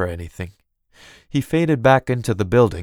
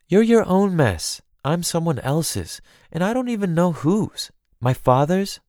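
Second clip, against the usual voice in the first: higher-pitched, self-pitying complaint; frustrated cousin; 6 semitones higher than usual. Acted. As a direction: let the weary grievance build in questions. Elaine vents to her cousin.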